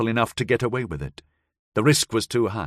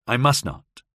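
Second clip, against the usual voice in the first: plain reading voice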